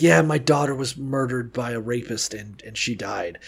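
a hint of a sad father, dropping away; mock grieving dad. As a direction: say it quiet and flat as it fades. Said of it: "some guy's like".

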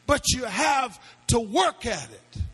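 loud rising preacher's cry, question after question; shouted sermon climax with music swelling; preaching at full force. Acted. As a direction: hammer each why like a revival preacher.

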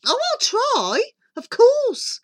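high, swooping, obliging voice; loud, high phone gush. Acted. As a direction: agree airily in a piping lady's voice.

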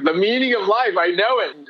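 high, elated declaration; euphoric. Retold or imitated. Recalling his euphoria at finding meaning, high and elated. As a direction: burst with joyful certainty.

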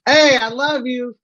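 slow, high, loud slur of affection; drunken yell. Acted. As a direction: holler it like a happy drunk. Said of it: "Now you sound like you're drunk".

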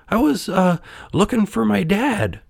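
put-on high childlike puppet voice; hesitant puppet. A narrator's reading. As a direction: explain haltingly in a high voice. Puppet explains himself.

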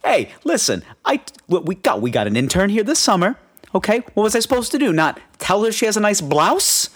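mild New York Italian uncle shrugging it off; Italian-American uncle. Retold or imitated. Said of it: "Very Italian, very just like".

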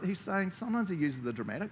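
normal talking voice

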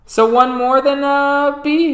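high, loud, hesitant call; slightly quivering father. A narrator's reading. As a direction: call out as Dad with a bit of a quiver. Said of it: "You hear a bit of quivering in his voice".

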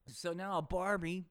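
ordinary talk, nothing marked

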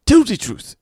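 high, loud mocking African accent; mock Will Smith African accent. To a friend, mocking Will Smith's Concussion African accent. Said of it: "is the kind of accent that he comes out with".

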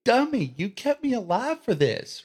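hammed-up indignant reproach at the one who saved you; mock outrage. Acting out Dan's angry reproach.

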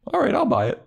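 very high-pitched, easily convinced; mock gullible. Riley falling for the fake accent, high mock-gullible. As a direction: squeak it out as a mock dupe.

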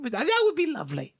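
high, mock-polite old-lady voice; old lady customer. Old lady accepting a remake, high put-on voice. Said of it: "This old lady comes up".

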